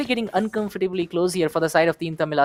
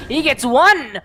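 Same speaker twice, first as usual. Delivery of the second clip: higher, louder hype call; excited shout; 6 semitones higher, 3 dB louder than usual. Real, not acted. Caster reacting to a kill.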